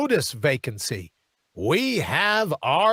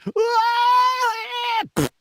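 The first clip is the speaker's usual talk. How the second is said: imitated scream.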